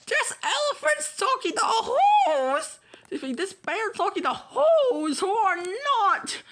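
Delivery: high, fast jeering outburst; mocking shout. Brothers mocking the bear, mock shouting. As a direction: jeer at the fool. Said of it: "came shouting".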